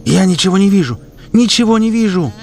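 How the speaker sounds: high, loud panicked cry; terrified cry. Acted. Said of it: "хрипит в ужасе". (кричит в ужасе)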